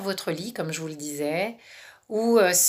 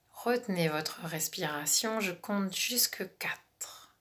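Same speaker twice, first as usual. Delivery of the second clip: calm relaxation guide, half whispered; guided meditation; 3 dB quieter than usual. Acted.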